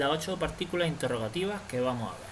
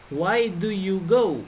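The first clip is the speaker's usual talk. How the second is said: Why do you go? said slowly and louder; model sentence; 4 semitones higher, 6 dB louder, much slower than usual. Shown as a demonstration. Teacher models the English question, slow and emphatic. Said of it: "model English sentence".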